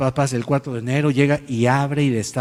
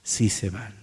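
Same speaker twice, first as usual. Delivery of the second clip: soft, lower smiling answer; smiling reply; 6 semitones lower, 8 dB quieter, slower than usual. Retold or imitated. Pastor confirming to the couple they can go, softly smiling. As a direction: let a quiet knowing smile into it. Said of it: "sonríe y dice".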